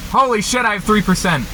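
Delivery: sudden alarmed high exclamation; surprised. Real, not acted. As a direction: caught off guard, voice jumps up. Battery almost dead in car.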